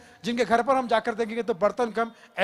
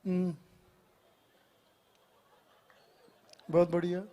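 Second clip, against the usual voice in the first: much lower, calm and unhurried; Pankaj Tripathi impression; 7 semitones lower than usual. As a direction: say it in a sly easygoing mutter. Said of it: "Pankaj Tripathi".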